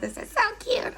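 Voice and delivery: high soft squeal of delight; squeaky gush. Real, not acted. Holding up a big sponge, squeaking with delight. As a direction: squeak it out adoringly.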